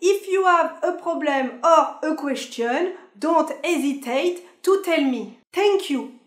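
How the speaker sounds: put-on slow, high train announcement in heavily French-accented English; mock French-accented English, high and drawn out; bad French accent. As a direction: mimic a French conductor's poor English accent, word by laboured word. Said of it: "c'est l'accent. Ah non, ça en est toujours aussi mauvais".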